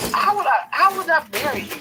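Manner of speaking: loud, high-pitched challenge; acted indignation. Visitor challenging the bickering couple, mock indignant. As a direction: push the question out high and loud, outraged.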